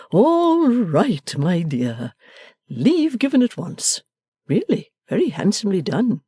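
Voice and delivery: put-on a gentle old father pleased with his daughter; father answering his daughter, lightly voiced and pleased; fond father. A narrator's reading. Father speaking in story.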